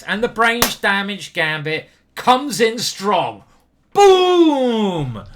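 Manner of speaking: loud triumphant boom; triumphant shout. Real, not acted. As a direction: announce it like a hit. Big move landing.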